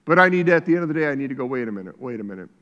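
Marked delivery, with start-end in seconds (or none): 1.5-2.5 low, soft wait a minute; hushed self-interruption; quiet self-check. Retold or imitated. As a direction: pull yourself up short, quietly. Self-talk, catching oneself.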